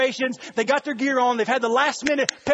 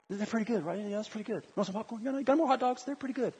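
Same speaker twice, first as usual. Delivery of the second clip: unimpressed spectator; 2 semitones lower, 11 dB quieter than usual. Acted. Concertgoer sitting through the headliner, hushed and underwhelmed. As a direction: toss it off quietly, barely interested. Mock bored audience sitting there.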